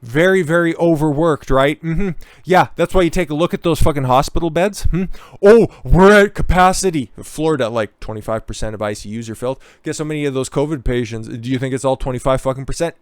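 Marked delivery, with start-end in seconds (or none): 5.4-7.1 mocking whine. Mocking hospital staff complaint.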